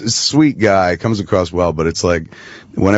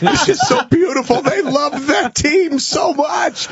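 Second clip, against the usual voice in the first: very high, tearful hammed-up wail; mock bawling; slower than usual. Husband explaining his tears to his wife, mock bawling. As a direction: blubber it in a squeaky mock sob, drawn out at the end. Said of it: "I'm, like, fucking bawling".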